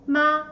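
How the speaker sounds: "Ma" held long on a flat, level pitch; "Ma" sustained without any rise or fall; first tone. Shown as a demonstration. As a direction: say "Ma" in Mandarin first tone, perfectly level. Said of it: "The first tone".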